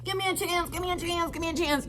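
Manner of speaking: high, soft, begging mock voice of the boyfriend; pleading cheater. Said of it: "he's like".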